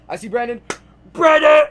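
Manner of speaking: loud shout across the crowd; shouting a friend's name over the crowd; calling out. Real, not acted. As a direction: yell his name to get his attention.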